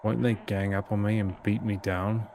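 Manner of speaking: ordinary delivery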